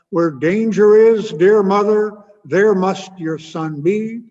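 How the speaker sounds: loud, slow, solemn declaration; heroic son's vow. Retold or imitated. Son solemnly vowing to his worried mother, loud and slow. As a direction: proclaim it like a noble vow. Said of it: "And he apparently said".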